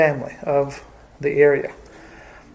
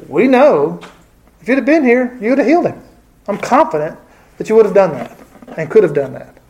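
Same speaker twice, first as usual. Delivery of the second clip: high, hopeful assurance; confident trust; 5 semitones higher than usual. Retold or imitated. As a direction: voice lifted in triumphant faith. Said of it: "a triumphant statement of confidence".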